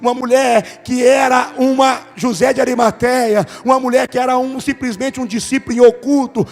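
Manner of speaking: loud, driving preacher's climax; full-force preaching. Real, not acted. Pastor closing the sermon to the church, loud and fervent. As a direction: preach it hard to the congregation.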